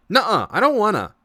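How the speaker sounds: put-on high, loud pouting child; whiny little girl. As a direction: whine it clinging to a leg. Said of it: "she just kind of clings to your leg".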